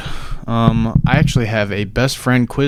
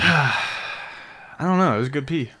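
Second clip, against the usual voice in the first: breathless after the bathroom trip; out of breath; 3 semitones higher, 6 dB quieter, slower than usual. Real, not acted. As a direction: catch your breath between words. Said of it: "Why you out of breath from pissing?".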